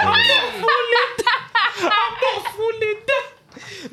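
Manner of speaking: drawn-out stubborn refusal. Retold or imitated. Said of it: "he put his hand on my head".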